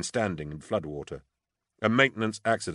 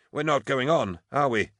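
higher-pitched doubtful question; uneasy soldier; 7 semitones higher than usual. A narrator's reading. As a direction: ask it as a reluctant soldier. Uneasy soldier voiced in story.

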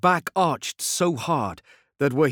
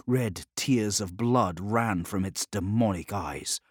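plain reading voice

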